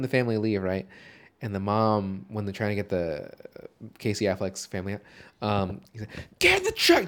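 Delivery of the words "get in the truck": high, loud mock yell. Host imitates man yelling at woman during escape, mock yell. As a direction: bellow an urgent order in imitation. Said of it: "Just yells at this woman".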